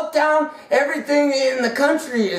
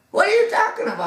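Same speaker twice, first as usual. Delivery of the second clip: startled disbelief; 10 semitones higher, much faster than usual. Acted. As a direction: voice jumps up high, stunned. Widow startled by Elijah's request.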